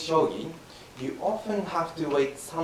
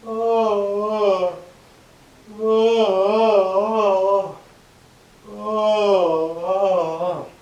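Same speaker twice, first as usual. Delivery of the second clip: loud drawn-out yawning oh; a satisfied, loud yawn; 4 semitones higher, 7 dB louder, much slower than usual. Shown as a demonstration. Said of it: "do just like this".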